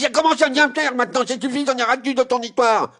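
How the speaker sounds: he has had enough of the joke, let it burst out high; exasperated outburst. Acted. Boy fed up with the daily joke, exasperated high outburst.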